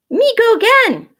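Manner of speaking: put-on high, loud toddler voice; baby voice. Baby Louie squealing to go again, high and loud. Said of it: "baby Louie said".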